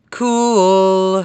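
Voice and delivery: high, held, loud single word; loud drawn-out cool. Real, not acted. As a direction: stretch it out loudly. Sarcastic reaction to game outcome.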